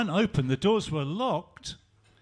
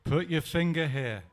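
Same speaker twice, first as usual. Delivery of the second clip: gentle; 6 semitones lower than usual. Acted. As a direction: gently invite him closer. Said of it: "Jesus said very gently".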